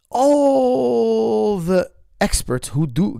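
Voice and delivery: slow, high, whiny mock cry; mock their crying, drawn out; mock whining. Cry and whine about that.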